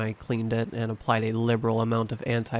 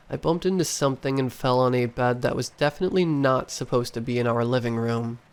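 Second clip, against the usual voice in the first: usual speaking voice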